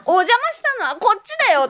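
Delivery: high, wry inner comeback; sarcastic retort. Retold or imitated. Speaker's inner retort to the hotel manager's apology, high and wry.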